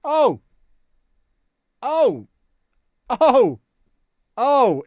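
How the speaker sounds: loud, high cries of dismay; real frustrated yells at the crash; exasperated shouts. Real, not acted. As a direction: yelp out in frustration each time.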